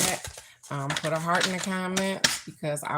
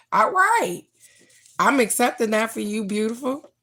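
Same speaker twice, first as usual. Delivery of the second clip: much higher and louder, beaming; excited cheer; 5 semitones higher, 6 dB louder than usual. Real, not acted. Host cheers a viewer's beach trip, excited and loud. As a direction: cheer her on at full voice.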